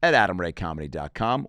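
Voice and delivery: normal talking voice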